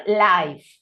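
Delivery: "Life" with an "eye" vowel; noun pronunciation. Shown as a demonstration. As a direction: model "Life" clearly, the i sounding like "eye". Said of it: "it is pronounced with".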